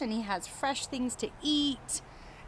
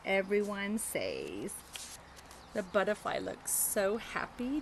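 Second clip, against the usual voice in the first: put-on reading to children. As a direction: read it gently to little kids, taking your time. Reading picture book to children.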